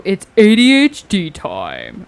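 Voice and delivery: loud, slow announcer-style jingle; sound-alert voice. Shown as a demonstration. Player demos a sound alert, loud drawn-out call. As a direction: belt it out, stretching the letters. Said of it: "Or maybe I should go".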